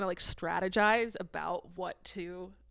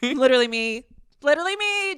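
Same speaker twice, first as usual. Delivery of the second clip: higher, louder squeal of literally me; excited; 6 semitones higher, 9 dB louder than usual. Real, not acted. Host claiming the contestant is her, high and loud. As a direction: proclaim it gleefully.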